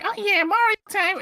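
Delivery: put-on very high, loud baby voice; Baby Mario voice. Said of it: "he goes like".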